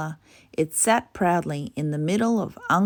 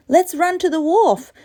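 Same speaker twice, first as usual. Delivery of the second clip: eager; 10 semitones higher, 8 dB louder than usual. Acted. Bala urging his sister.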